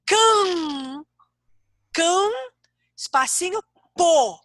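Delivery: "Cam" held long and high on the nasal, then a separate "pô"; demonstrated pronunciation. Shown as a demonstration. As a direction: stretch CAM, repeat it, pause before PÔ. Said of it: "olha a minha pronúncia".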